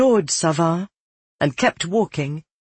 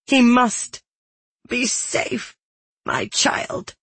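high, breaking plea, voice giving way; voice cracking; 9 semitones higher, slower than usual. A narrator's reading. Woman parting from the smith she calls child, voice cracking. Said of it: "Her voice cracked".

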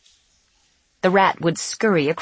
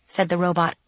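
ordinary delivery, nothing marked

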